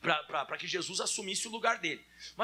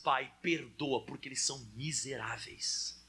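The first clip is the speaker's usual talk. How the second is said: Jesus praying; 6 semitones lower than usual. Acted. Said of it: "E ele disse".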